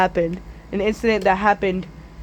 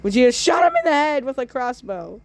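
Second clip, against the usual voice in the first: ordinary delivery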